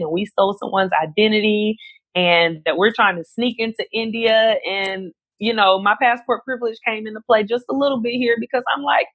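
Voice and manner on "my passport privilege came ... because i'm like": ordinary talk, nothing marked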